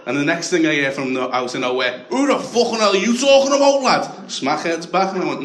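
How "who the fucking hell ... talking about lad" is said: loud, high mock shout; sudden raised-voice outburst, acted for laughs; angry challenge. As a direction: imitate an aggressive stranger butting in. Smackhead shouts out of nowhere.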